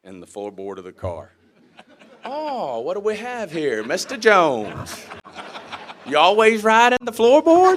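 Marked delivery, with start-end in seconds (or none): 2.2-7.8 loud, high, drawn-out taunt; hammed-up authority figure relishing the catch; mocking colonel. Colonel catches student hiding in the floorboard, mocking.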